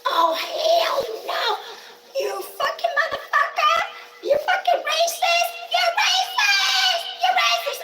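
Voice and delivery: very high, squealing mock scream; hammed-up falsetto screeching; imitated top-of-lungs screaming. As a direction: shriek it like the hysterical housemate, drawing words out. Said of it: "She be screaming at the top of her lungs".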